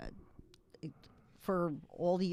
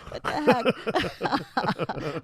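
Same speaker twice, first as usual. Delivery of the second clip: drawn-out what the heck; 4 semitones higher, 6 dB louder, much slower than usual. Acted. Exaggerated reaction to ex's joke.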